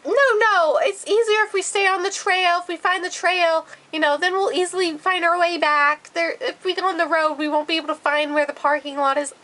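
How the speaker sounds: high-pitched mock dad voice; high, singsong parody of fatherly reasoning; mimicked insistent dad. Acting out dad arguing for trail.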